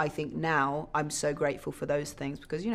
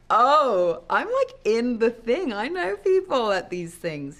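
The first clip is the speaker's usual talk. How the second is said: mock starstruck self; 7 semitones higher, 7 dB louder, slower than usual.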